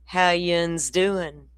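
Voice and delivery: slow, stretched-out Appalachian Tennessee drawl; Tennessee mountain drawl. Retold or imitated. Tennessee shopkeeper greeting Florida girls, in a slow, lazy drawl. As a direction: drag every word like the sloth-slow mountain man. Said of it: "He says".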